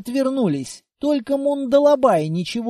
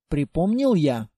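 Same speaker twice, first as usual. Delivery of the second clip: plain reading voice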